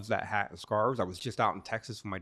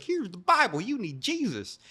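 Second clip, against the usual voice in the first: higher, louder sing-song taunt; mocking preacher; 10 semitones higher, 6 dB louder than usual. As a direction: tease him like a smug preacher. A guy mocks him in solitary.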